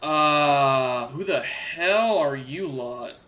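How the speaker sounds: slow, cocky drawl; cocky Nazarick. Acted. Cocky armored man sizing up the party, slow and dismissive. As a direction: draw it out with cocky disdain. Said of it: "with kind of a cockier step".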